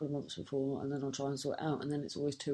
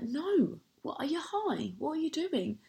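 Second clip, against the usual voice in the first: much higher, shocked disbelief; incredulous retort; 10 semitones higher than usual. Retold or imitated. Retelling her reply to the delivery guy, higher and incredulous. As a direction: pitch it way up, as if he's lost it. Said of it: "So then I was just like".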